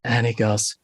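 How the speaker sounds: ordinary delivery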